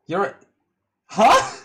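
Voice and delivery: sharp high startled huh; shocked. Real, not acted. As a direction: gasp it out in disbelief. Shocked reaction.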